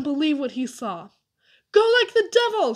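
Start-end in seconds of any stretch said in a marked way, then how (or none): 1.7-2.7 high, loud urgent shout. A narrator's reading. Dink to the boys as the tramp appears, shouted. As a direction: yell it as a signal to bolt. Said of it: "shouted Dink".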